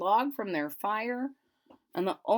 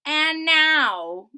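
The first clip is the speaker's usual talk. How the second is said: loud, higher sly Grinch voice; grinning Grinch; 7 semitones higher, 12 dB louder than usual. Acted. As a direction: gloat like a scheming Grinch. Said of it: "grinned the Grinch".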